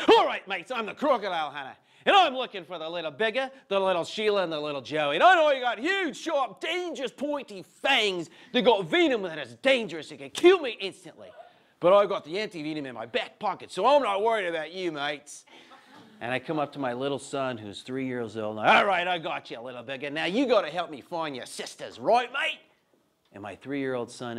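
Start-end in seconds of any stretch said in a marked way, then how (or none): 0.1-15.4 big, hammed-up Australian wildlife showman; high, loud Aussie accent, hyping up the danger; Australian Crocodile Hunter. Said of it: "I'd start out like this". 18.7-22.0 playful, hammed-up Aussie wildlife showman; put-on Australian accent, recruiting a helper; Australian Crocodile Hunter. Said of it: "I'd start out like this". 22.0-22.6 high, loud, hammed-up Aussie question; broad Australian accent, pitched way up; Australian Crocodile Hunter. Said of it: "I'd start out like this".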